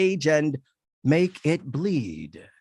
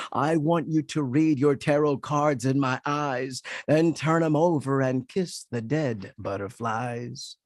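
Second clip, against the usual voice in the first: ordinary talk, nothing marked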